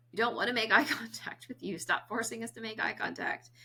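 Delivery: mock protest. Adults on the spectrum objecting to therapists, lightly mock loud. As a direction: push back on forced eye contact. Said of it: "adults on the spectrum that are saying".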